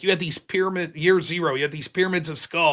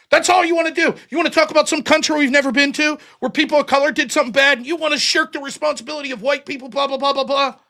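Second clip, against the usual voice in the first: high, loud, fast mock tirade; mocking rant; 8 semitones higher, 3 dB louder, faster than usual. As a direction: ham up a self-righteous rant.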